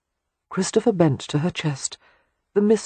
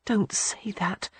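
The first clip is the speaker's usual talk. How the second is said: slow, soft pleading whisper; 5 semitones higher, 8 dB quieter, slower than usual. A narrator's reading. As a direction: beg him softly, slowly.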